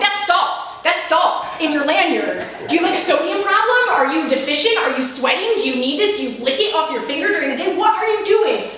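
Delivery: high, loud, rapid incredulous rant; exasperated counselor ranting at camper, pitch way up; losing it. Retold or imitated. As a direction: lose it at the kid in disbelief. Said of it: "And I'm losing it".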